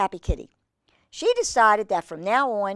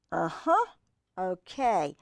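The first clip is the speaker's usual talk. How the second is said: usual speaking voice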